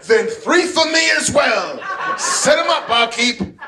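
loud laughing bravado; hammed-up bar order. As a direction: order drinks like an old cowboy. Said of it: "And I'm like".

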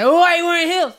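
high, loud whiny put-on complaint; mocking men. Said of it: "men are always like".

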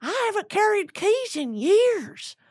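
high, slow, put-on star voice. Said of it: "When she's like".

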